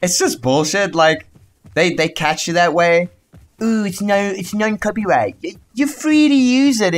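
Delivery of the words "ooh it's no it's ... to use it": high, drawn-out mock voice; exaggerated high-pitched mockery; mocking imitation. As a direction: mimic them in a silly high, slow voice. Mimicking music posters who later claim videos.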